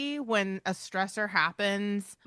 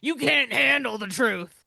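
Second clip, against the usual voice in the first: lightly voiced gruff movie line, louder; movie quote impression; 4 semitones higher, 5 dB louder than usual. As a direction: do a hint of the famous courtroom yell. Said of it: "start sounding more like".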